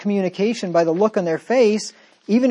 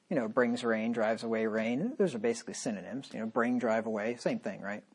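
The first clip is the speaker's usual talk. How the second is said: low, soft, dry sarcasm; deadpan mock agreement, quiet and low; 8 semitones lower, 11 dB quieter than usual. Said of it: "in case you didn't get the sarcasm there".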